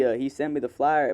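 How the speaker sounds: normal talking voice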